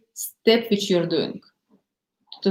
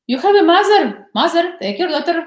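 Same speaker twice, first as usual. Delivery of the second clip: exasperated husband; 8 semitones higher, much faster than usual. Retold or imitated. Worn-out husband begging his wife to take the daughter, pleading. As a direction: beg her to take the child.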